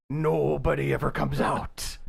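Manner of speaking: lightly voiced doom-prophet; slow creepy warning; ominous old man. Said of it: "why'd you sound".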